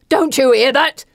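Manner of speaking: high, loud panicked yell; panicked scream. Acted. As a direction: scream it at the men. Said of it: "screams at his men".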